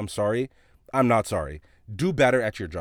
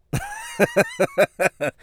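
real laughing fit. Real, not acted. Storyteller recalling their reaction to "clowns", laughing hard. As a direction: burst out in helpless high laughter.

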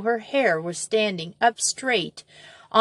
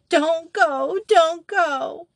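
put-on high wailing child's plea; piteous howl; 10 semitones higher, 4 dB louder, much slower than usual. A narrator's reading. Molly wails up to Betsy from the pit, piteous. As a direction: wail it from the dark pit. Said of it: "in a piteous howl".

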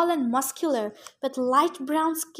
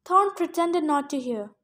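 ordinary delivery

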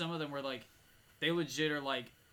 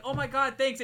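grateful exclamation; 9 semitones higher, 9 dB louder than usual. Retold or imitated. Acting out relieved, grateful driver.

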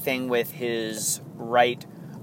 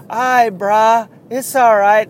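put-on San Diego accent; 9 semitones higher, 8 dB louder than usual. Fans reassuring a struggling pitcher, San Diego accent. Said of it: "That's my San Diego accent".

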